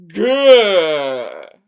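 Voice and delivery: loud frustrated grr; loud growl of frustration; angry growl. A narrator's reading. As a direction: growl as you storm off. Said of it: "as she stormed off".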